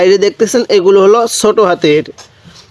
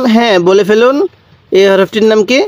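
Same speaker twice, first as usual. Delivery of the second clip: usual speaking voice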